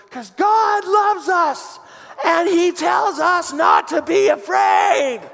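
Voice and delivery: put-on very high, slow little-girl shout; squeaky child voice, slow and emphatic; toddler daughter. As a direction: proclaim it like an earnest small child. Voices daughter declaring her faith.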